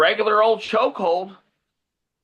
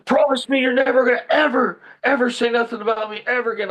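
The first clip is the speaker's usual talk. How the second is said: raised, louder retold ultimatum; fierce demand; 6 semitones higher, 4 dB louder than usual. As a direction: hammer it out while pinning him. Retelling his demand while choking bully.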